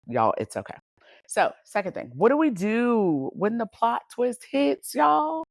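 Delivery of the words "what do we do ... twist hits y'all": higher, rising, fired-up ask to the crowd; excited rhetorical question. Real, not acted. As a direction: throw the question out to y'all.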